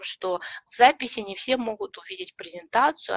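normal talking voice

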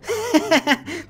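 laughter. Acted. Son laughs again at the name, giggling. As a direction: keep giggling at the joke.

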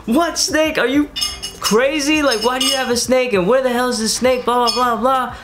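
shrill high mock mom yelling; imitating mom. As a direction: squawk like a furious mom. Mom yelling about the snake.

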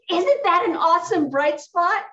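high, loud delighted question; real excitement. Real, not acted. Volunteer sharing her bright spot with the group, excited. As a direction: burst out with the happy punchline.

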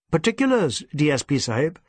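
plain reading voice

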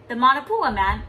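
ordinary delivery, nothing marked

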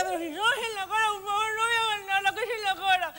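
high, tearful begging; hammed-up weepy pleading; mock crying plea. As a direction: whimper please don't. Begging staff, crying sound.

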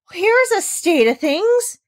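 high, slow shocked exclamation of a stern lady; Rottenmeier exclaiming. A narrator's reading. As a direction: gasp it out, appalled. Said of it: "exclaimed Missus Rottenmeier".

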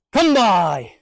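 firm single command; single called command. Shown as a demonstration. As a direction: call it once, firmly.

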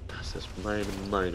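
breathy echoed whisper. Pretend. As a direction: whisper it back softly. Echoing the line in a whisper.

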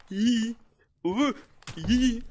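high, loud squeals; playful squeals. Real, not acted. Running from a player, high playful squeals. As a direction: squeal it out while running away.

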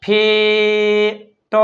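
long drawn-out vowel, then a separate final T; sounded out. Shown as a demonstration. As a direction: stretch ફેટ slowly, sound by sound. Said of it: "ફ એટલે". (ફેટ લંબાવીને, અવાજ છૂટા પાડીને)

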